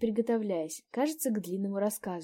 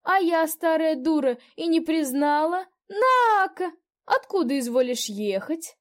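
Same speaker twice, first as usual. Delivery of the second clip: put-on higher, louder delighted old peasant woman; old landlady; 9 semitones higher, 11 dB louder, slower than usual. A narrator's reading. Old landlady greets a guest she knows, delighted. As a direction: gush over a familiar face.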